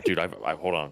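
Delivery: normal talking voice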